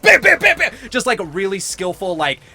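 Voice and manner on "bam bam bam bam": loud high imitated hammer blows; hammer noises. Describing an old man nailing, loud hammer bams. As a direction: punch out each bam like a nail strike. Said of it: "He's just like".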